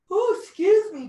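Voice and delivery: words stretched through a yawn. Real, not acted. Instructor yawns mid-class, apologising through the yawn. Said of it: "big yawn".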